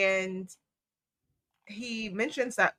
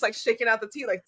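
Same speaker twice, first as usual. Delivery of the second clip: ordinary delivery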